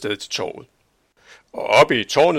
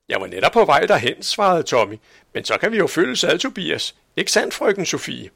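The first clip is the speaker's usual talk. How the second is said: fast, higher, lightly voiced eager young man; eager Tommy; 6 semitones higher, 5 dB louder than usual. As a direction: hurry to join in, pleased. Tommy svarer ivrigt. (hurtig og ivrig Tommy)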